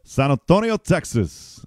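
high, put-on Mexican accent on San Antonio; Spanish accent. Shown as a demonstration. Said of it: "Spanish or Mexican out, uh, accent".

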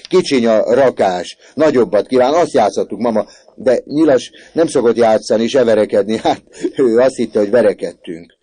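put-on loud, high, breathless-child explanation; excited shouting. A narrator's reading. Orci explaining the game to his mother, excited shouting. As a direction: rattle off the excuse at full voice. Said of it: "kiáltotta Orci".

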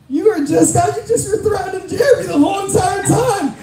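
very high-pitched mock disbelief; incredulous retort. Auction buyers turning on the complainer, high mock indignation. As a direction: throw it back in a squeaky, indignant imitation.

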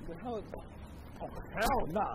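indignant refusal; indignant outburst. Acted. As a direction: blurt it out, offended. Indignant at the interviewer's insult.

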